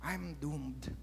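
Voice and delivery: imitating a soldier who knows he's done for; mock dread. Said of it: "he would say".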